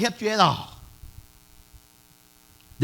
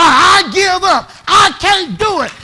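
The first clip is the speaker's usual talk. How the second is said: loud, higher and slow cry of surrender; desperate shout; 7 semitones higher, 8 dB louder, slower than usual. Retold or imitated. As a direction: shout it out like someone with nothing left. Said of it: "three to four words you gonna say".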